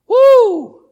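loud high whoop. Real, not acted. Preacher whooping to fire up the church. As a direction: let out a joyful shout.

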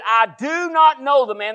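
high, forceful disowning; emphatic denial. Acted. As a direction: act out an exasperated final denial. Peter's third, emphatic denial.

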